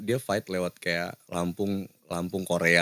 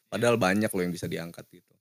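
ordinary talk, nothing marked

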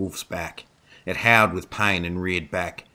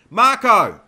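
loud, high cry of a name; desperate shout; 11 dB louder than usual. A narrator's reading. Dana hears Marco scream in the smoke, desperate shout. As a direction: call out in alarm for a lost companion. Calling out after his scream.

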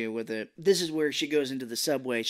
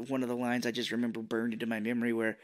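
normal talking voice

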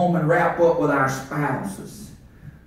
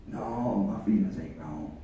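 much lower, much softer stubborn reply; answering inner voice; 12 semitones lower, 13 dB quieter than usual. Acted. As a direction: mutter back a low, quiet denial. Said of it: "I'm preaching that war that goes on the inside".

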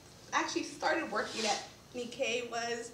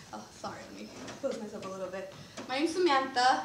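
ordinary talk, nothing marked

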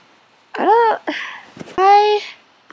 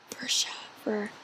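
whisper; 4 semitones lower, 13 dB quieter than usual. Real, not acted. Whispered aside while playing.

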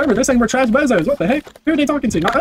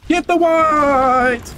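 very slow, stretched battle cry; drawn-out call; 4 semitones higher, much slower than usual. Acted. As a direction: stretch every word out long. Drawn-out battle cry mid fight.

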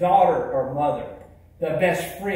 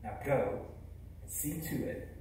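softer, low and tender blessing; gentle reassurance; 3 semitones lower, 11 dB quieter than usual. Acted. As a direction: comfort the ashamed boy softly. Speaker repeats the blessing to audience.

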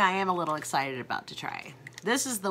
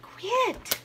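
sprayed with water; 4 semitones higher than usual. Real, not acted. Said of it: "It's in my mouth".

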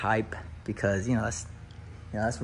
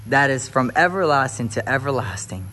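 preaching build; 3 semitones higher, 5 dB louder than usual. Acted. Husband preaching the eternal home to viewers, louder. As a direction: build it like a pastor calling for the organ. Sermon continues.

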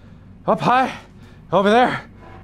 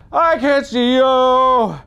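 very slow, high taunting call; high drawn-out taunt; 6 semitones higher, much slower than usual. Pretend. Player taunting an enemy, high drawn-out taunt. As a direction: draw each word out high. Taunting, building over three takes.

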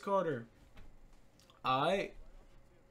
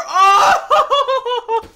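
a high, loud whoop with a laugh; real joyful whoop; laughing shout; 17 dB louder than usual. Real, not acted. Hype moment in game.

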